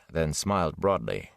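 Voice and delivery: plain reading voice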